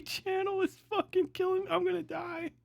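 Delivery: ordinary talk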